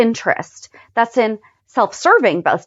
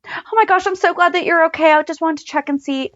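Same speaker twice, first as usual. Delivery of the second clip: much higher, bubbly mock relief; gushing relief; 7 semitones higher than usual. Sam checks on her best friend Carly, high gushing relief.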